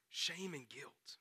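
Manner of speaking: a slow hushed echo; drawn-out soft whisper. Acted. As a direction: whisper it slowly, letting it sink in. Preacher echoes in a hush.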